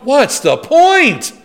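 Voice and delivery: slow, high and loud, mock exasperation; exasperated. Preacher to congregation, joking about the revived man, slow, loud and exasperated. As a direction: drag it out like it's all pointless. Joke about rising to face swords.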